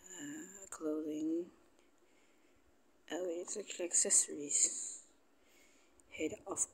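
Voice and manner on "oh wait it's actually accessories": ordinary talk, nothing marked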